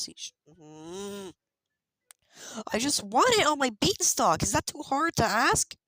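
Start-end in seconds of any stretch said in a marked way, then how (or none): 2.7-5.7 much higher and louder, cracking exasperated plea. Real, not acted. Gamer begging for a beanstalk, voice cracking in frustration. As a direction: push up until the voice breaks. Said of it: "Did my voice just crack?".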